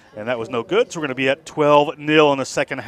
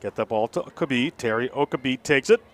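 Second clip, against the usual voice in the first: ordinary talk, nothing marked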